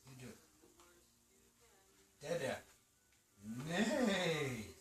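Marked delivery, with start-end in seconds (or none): 3.7-4.7 long neigh sliding downward; horse neigh. Acted. Animal noise from board book.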